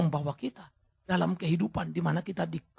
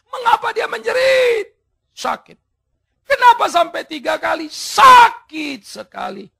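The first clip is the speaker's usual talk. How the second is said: much higher, loud preaching cry, slow; impassioned shout; 12 semitones higher, 11 dB louder, slower than usual. Real, not acted. Preacher crying out why Paul screamed, loud. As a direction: cry out the question.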